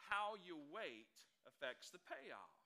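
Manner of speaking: "How" stressed, the whole line slow and deliberate; slow emphatic maxim. Shown as a demonstration. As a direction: land the key line slowly, hitting "How". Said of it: "slow emphatic key line".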